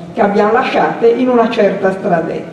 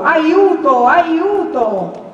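high, drawn-out call for help; narrated yell, pitched up; cry for help; 6 semitones higher, much slower than usual. A narrator's reading. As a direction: cry out in alarm from far across the night. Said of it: "quel primo sgangherato grido di Don Abbondio".

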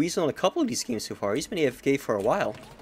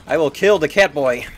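determined gamer; 10 dB louder than usual. Real, not acted. Hunting enemy mid-game.

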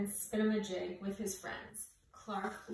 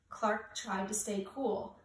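plain reading voice

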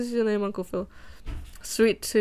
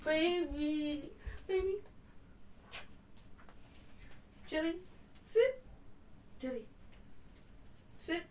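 talking to the dog; 5 semitones higher, 12 dB quieter than usual. Real, not acted. Talking to her dog Jellyfish.

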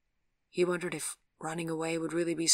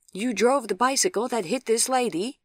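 loud, high, disbelieving question; incredulous policeman; 7 semitones higher, 9 dB louder than usual. A narrator's reading. Second policeman questions the rider about the crash, loudly incredulous. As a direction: query it as if hard to believe.

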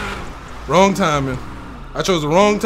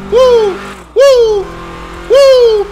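loud, high hype yells; excited whoops; 14 semitones higher, 5 dB louder, much slower than usual. Real, not acted. Driver hyped mid police chase, loud whoops. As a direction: whoop like you're on a thrill ride.

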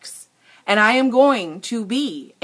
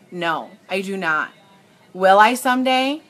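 ordinary talk

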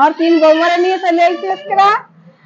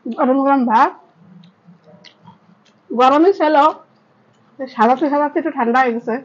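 speaking between bites; chewing while talking; 3 semitones lower than usual. Real, not acted. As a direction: mouth partly full of rice. Seen 2: left woman eats from leaf, mouth working while speaking.

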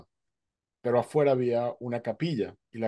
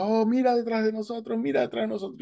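high excited call to look behind; retold, higher, calling his attention to the dolphins; excited pointing out; 11 semitones higher than usual. Rescuer spots dolphins behind boat.

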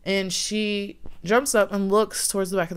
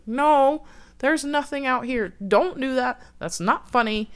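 put-on higher-pitched, exasperated retelling of a boyfriend; mildly indignant, raised pitch; annoyed denial; 6 semitones higher, 3 dB louder than usual. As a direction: lightly act out an insistent brush-off. Boyfriend snaps back at frightened girlfriend.

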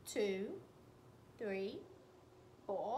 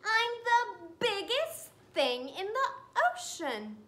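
proud squid singing its boast, higher and louder; sing-song boast; 4 semitones higher, 5 dB louder than usual. Acted. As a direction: chant it like a proud little song.

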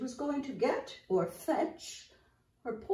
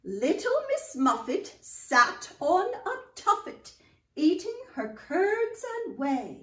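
nursery rhyme recitation; 5 semitones higher than usual. Acted. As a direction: recite it big for a room of children.

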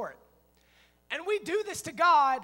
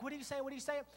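much softer, small bewildered voice; puzzled mock sister; 9 dB quieter, much faster than usual. Sister standing in the trail, quietly puzzled. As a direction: imitate a clueless kid, quietly repeating.

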